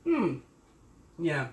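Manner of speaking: talking through a mouthful; mouth full. Real, not acted. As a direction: say it while chewing. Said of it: "Excuse me, talking with my mouth full".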